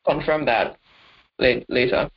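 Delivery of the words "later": ordinary talk, nothing marked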